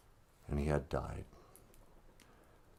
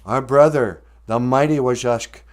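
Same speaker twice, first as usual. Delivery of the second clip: louder, higher, marvelling; joyful amazement; 4 semitones higher, 9 dB louder than usual. Acted. The brothers find sand in the little paw, joyful and loud. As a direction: announce the miracle with rising wonder.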